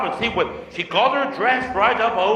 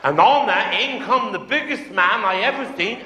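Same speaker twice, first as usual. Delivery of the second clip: ordinary delivery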